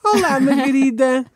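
high, slow sing-song imitation of her greeting; bright squeaky sing-song welcome. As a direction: stretch it out high and sweet. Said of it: "Olá, amiguinhos!".

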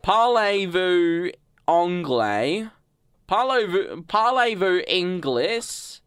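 slow, high, broad Aussie French question; French in an Australian accent. Shown as a demonstration. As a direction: stretch the French in mock Australian. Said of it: "spoke French in an Australian accent".

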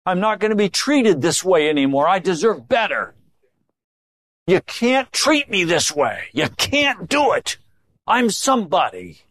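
high-pitched, aggrieved protest; mock indignation. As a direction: play someone wronged and self-important. Acting out a self-important aggrieved person.

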